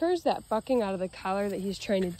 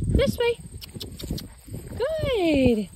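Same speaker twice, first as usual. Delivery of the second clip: This way sung out much higher; high command call; 12 semitones higher, much slower than usual. Shown as a demonstration. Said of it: "I always like to use the command".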